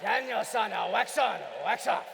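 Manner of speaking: bad imitation of an old karate master's Japanese accent; hammed-up Japanese-accented sensei, punched out; Mister Miyagi. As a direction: put on a comic Japanese movie-mentor accent. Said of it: "my very bad Mister Miyagi accent".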